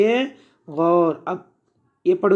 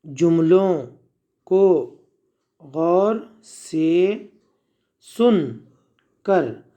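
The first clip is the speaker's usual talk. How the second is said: dictation pace; much slower than usual. Shown as a demonstration. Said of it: "बोलो".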